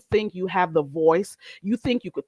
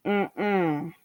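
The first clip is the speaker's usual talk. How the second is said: ordinary talk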